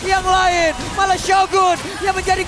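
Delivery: esports caster. Acted. Live play-by-play casting.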